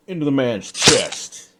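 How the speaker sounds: ordinary delivery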